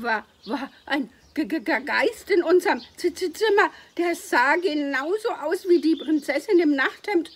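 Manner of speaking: high, loud, panicked stammering; frightened stutter. A narrator's reading. Frightened boy stammers story.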